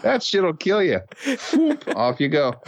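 high, cheerful mocking voice; mock kid jumping. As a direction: toss it off as you leap. Mocking playground jumper.

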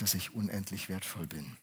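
normal talking voice

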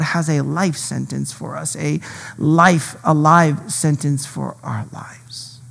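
slow, hushed whisper; preacher whispering the promise to the congregation. Real, not acted. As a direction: let the key phrase fall to a whisper. Sermon point's quiet close.

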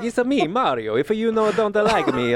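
high, hammed-up Mario accent; cartoon Italian, It's a me. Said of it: "vaguely Italian".

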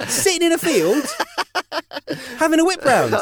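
high, laughing incredulity; laughing disbelief. Real, not acted. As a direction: laugh it out, amazed they're all up.

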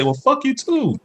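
high mock woman snapping; imitating her on the phone. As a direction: toss off her phone line, mock female. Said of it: "I hear her say".

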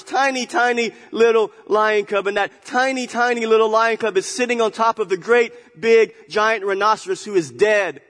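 high, loud, lively storyteller voice; excited story voice; animated storytelling. Acted. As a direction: tell it big to the congregation.

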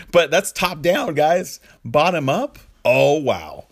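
suddenly lit up by the idea; amused excitement. Real, not acted. Mocks top-down, marvels at bottom-up.

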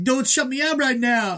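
high strained mock yell; mock yell at the door. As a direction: ham up his pleading yell. Adonis yelling at her door.

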